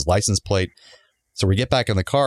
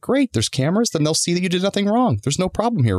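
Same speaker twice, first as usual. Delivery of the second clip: much higher, bright mock-cheerful comeback; sarcastic retort; 11 semitones higher than usual. Speaker needling the tow driver about cameras, much higher mock-cheer. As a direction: fake delight to needle him.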